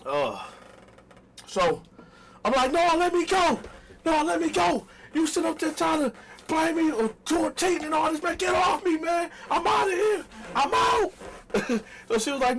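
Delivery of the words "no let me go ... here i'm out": hammed-up shout. To his girlfriend, a hammed-up yell to let him go.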